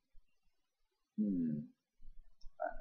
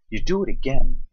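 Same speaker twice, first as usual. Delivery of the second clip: raised, much louder mock insistence; mock insistent; 7 semitones higher, 13 dB louder than usual. Shown as a demonstration. Mock-bossy, telling the viewer to do it again. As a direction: call it out like an order, half joking.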